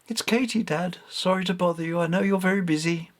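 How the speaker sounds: sorry to bother busy dad; apologetic daughter. A narrator's reading. Katie phoning her father, apologetic. Mother on the phone.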